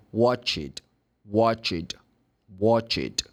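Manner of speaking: "watch it" for watched, slow, ending in a crisp t; separated sounds. Shown as a demonstration. Teacher rebuilding the past tense for students, slow "watch it" with final t. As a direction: join wa, ch and t slowly into "watch it". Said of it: "it's good to break up".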